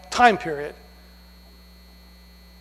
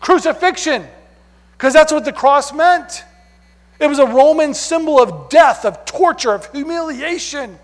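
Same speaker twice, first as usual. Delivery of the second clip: emphatic preaching; 7 semitones higher, 4 dB louder than usual. Acted. Preacher stresses cross meaning.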